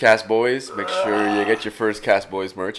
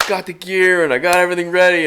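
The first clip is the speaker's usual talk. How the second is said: higher, louder pumped-up quote; hyped friend; 9 semitones higher, 4 dB louder than usual. Retold or imitated. Imitating Lang hyping the ice fishing gear, higher and louder. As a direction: sell the trip like an eager buddy. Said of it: "he got hyped".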